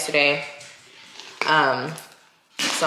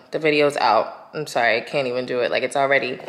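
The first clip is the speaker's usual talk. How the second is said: ordinary talk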